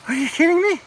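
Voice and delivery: high, incredulous cry of disbelief; can't believe your eyes, voice jumps up; amazed disbelief. Real, not acted. Vlogger stunned by the ruins below, high amazed disbelief.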